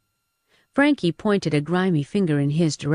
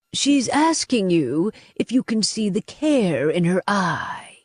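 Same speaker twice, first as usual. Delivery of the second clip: helpfully spelled out, raised pitch; helpful explaining; 3 semitones higher than usual. A narrator's reading. Caged man interpreting her gesture for them, helpful explaining. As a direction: explain it obligingly, a little higher. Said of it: "Simon said helpfully".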